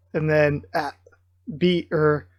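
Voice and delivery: ordinary delivery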